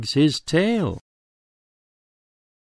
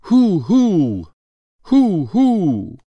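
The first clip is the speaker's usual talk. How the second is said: higher, louder owl hoots; 7 semitones higher, 5 dB louder, slower than usual. A narrator's reading. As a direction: hoot like an owl. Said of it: "An owl hoots".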